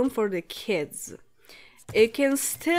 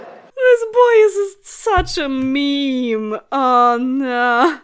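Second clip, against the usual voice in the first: laughing; 3 semitones higher, 9 dB louder, much slower than usual. Real, not acted. Laughing at the meme name.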